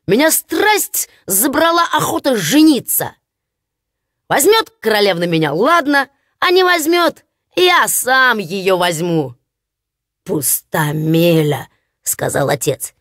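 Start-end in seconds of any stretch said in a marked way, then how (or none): 0.1-9.3 brash, higher simpleton voice; cocky simpleton. Acted. Hans boasting to his father about marrying, brash. 10.2-11.7 lower, slow scolding father; stern father. Acted. Father scolding Hans, low and slow.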